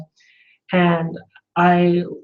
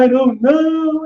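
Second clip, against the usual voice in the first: high, slow teary voice; husband asks what's wrong, she answers crying high and slow; retold sobbing reply; 9 semitones higher, slower than usual. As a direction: choke it out through tears, pitched way up. Crying after the meditation vision.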